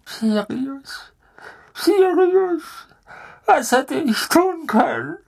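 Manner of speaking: high, tearful begging for mercy; sobbing plea. Acted. Pettigrew begs Sirius through tears, sobbing. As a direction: whimper his name through tears. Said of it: "Pettigrew brach in Tränen aus".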